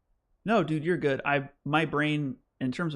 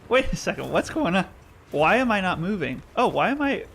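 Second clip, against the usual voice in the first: higher and louder, startled questions; real surprise; 6 semitones higher, 5 dB louder than usual. Real, not acted. Streamer alarmed his character won't move mid-fight, startled. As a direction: react as the controls suddenly fail.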